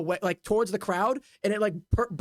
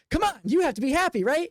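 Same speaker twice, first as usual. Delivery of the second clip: higher, quick, cheery coaxing; smiling juggler; 7 semitones higher than usual. Acted. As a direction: coax with a smile, pitched up and brisk. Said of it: "like, smiles".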